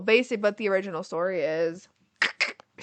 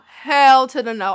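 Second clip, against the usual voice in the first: emphatic refusal; 3 semitones higher, 11 dB louder than usual. Real, not acted. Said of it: "emphatic refusal".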